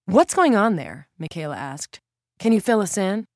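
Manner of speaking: probing interviewer; reporter questioning. Acted. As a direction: press the source gently.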